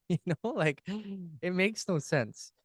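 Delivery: real excitement, high-pitched but soft; worked up. Real, not acted. Co-host worked up over his own cloud analogy, excited and high. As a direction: get worked up, voice climbing as you slow down. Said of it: "Sorry, I'm getting excited here".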